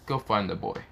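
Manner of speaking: ordinary delivery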